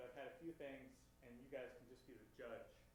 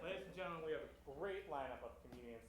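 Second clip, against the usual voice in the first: host announcement; 3 semitones higher, 5 dB louder than usual. Acted. Introducing the show.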